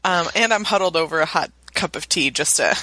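husky, rough voice from a cold; hoarse sick voice. Real, not acted. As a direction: rasp through a sore throat. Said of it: "that is a good voice".

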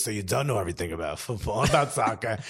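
low, soft New York mother impression; New York accent. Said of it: "And she goes".

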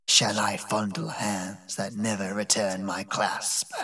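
slow bitter whisper. A narrator's reading. Old servant Zaroba answering her master, slow hushed whisper. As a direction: breathe it out, hushed and hurt.

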